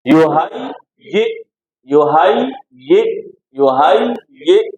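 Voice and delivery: यू हाई ये in three slow separate sounds; sounds separated. Shown as a demonstration. Teacher splits the word for a student, slow syllabled demo. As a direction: break यू हाई ये apart, unhurried, each syllable distinct. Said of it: "sound को अलग-अलग करें".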